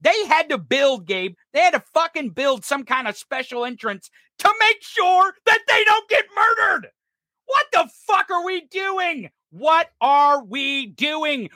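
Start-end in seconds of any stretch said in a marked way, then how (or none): 4.4-11.5 outraged shout. Real, not acted. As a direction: shout it out in disbelief at the madness. Outraged shout about school.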